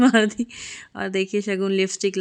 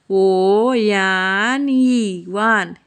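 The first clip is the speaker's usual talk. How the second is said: drawn-out coaxing; 4 dB louder, much slower than usual. Retold or imitated. Papa's trick to make her speak.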